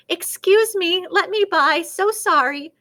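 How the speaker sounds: high, loud, urgent pardons; hurried apology. A narrator's reading. Turkey shoves into a bunch of birds, hurried apology. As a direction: push through with flustered apologies.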